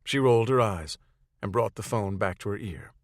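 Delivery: usual speaking voice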